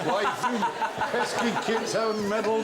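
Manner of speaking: high, slow, laughing villain gripe; cartoon villain. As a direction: whine like the unmasked villain. Scooby-Doo villain line.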